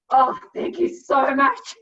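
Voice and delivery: mock overjoyed gratitude; hammed-up thankful sigh of relief; exaggerated relief. Relieved to be sent back.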